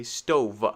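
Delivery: "stove" wrongly said as stove-vuh; added vuh ending. Shown as a demonstration. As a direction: add an extra uh to the end of "stove". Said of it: "Be careful not to say".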